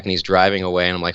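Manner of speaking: normal talking voice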